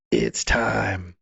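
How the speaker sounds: very low, grave villain voice; ominous farmer. Retold or imitated. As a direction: drop deep for a sinister announcement. Voicing the farmer's ominous line.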